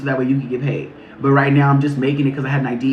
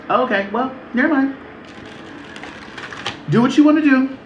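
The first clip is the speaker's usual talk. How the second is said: high, airy never-mind shrug; breezy, higher, fake indifference; mock dismissive; 9 semitones higher than usual. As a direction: toss it off like you don't care. Mock giving up, pitch way up.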